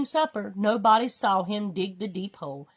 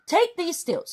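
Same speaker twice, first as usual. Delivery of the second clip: commanding; 8 semitones higher than usual. A narrator's reading. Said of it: "commanded Lord Droon".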